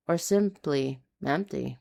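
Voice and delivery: plain reading voice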